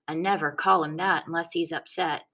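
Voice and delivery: plain reading voice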